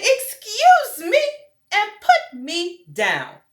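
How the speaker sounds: high, loud, slow offended rabbit; indignant rabbit. Acted. Rabbit telling Douglas to put it down, high and indignant. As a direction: huff at being grabbed.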